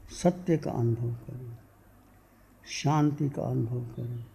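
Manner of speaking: measured meditation voice with long pauses, softening as it goes; guided meditation. Real, not acted. Teacher leading seated devotees into inner silence, guided meditation. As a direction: guide listeners inward, phrase by phrase, letting endings fade.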